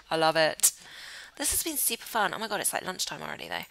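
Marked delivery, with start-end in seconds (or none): none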